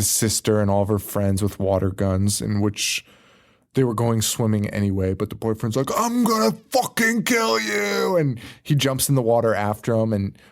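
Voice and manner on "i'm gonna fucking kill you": high, slow, yelled threat; shouted threat, high and drawn out; mock angry shout. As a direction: yell it like a furious teen boyfriend. Boyfriend shouts at kids.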